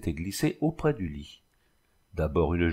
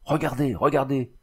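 startled cry of a grieving husband; strange cry; 5 semitones higher than usual. A narrator's reading. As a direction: call out in a strange voice. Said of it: "Il cria d'une voix étrange".

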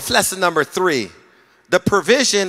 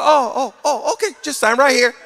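high relieved boss voice; 10 semitones higher than usual. Retold or imitated. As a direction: squeak out relieved agreement. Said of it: "And they go".

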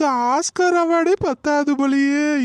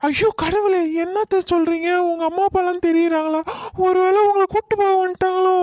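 mock alarm; 7 semitones higher than usual. Daughter-in-law mocks her mother-in-law's fake illness with alarm. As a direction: pretend horror to call her bluff. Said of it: "உன் நடிப்பெல்லாம் உன் வாயாலே வர வைக்கணும்".

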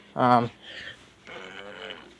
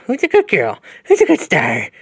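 pet talk; 4 semitones higher, 3 dB louder than usual. Real, not acted. Owner cooing at new cat.

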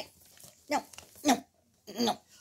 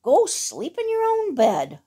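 high, bossy hen voice; cross hen; 7 semitones higher than usual. A narrator's reading. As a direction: scold like an indignant hen. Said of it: "squawked Hen".

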